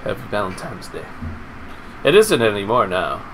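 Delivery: ordinary delivery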